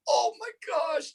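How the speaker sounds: high, slow dazed gasp of words; stunned squeak after the crash; a high dazed cry; shocked exclamation. Retold or imitated. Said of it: "I'm like".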